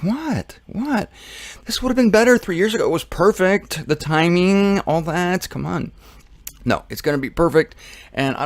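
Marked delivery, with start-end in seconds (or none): 0.0-5.9 high-pitched mock protest; excited, baffled Jesus. Jesus protesting to scowling believers his timing was perfect, excited. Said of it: "Jesus is all excited. He's like".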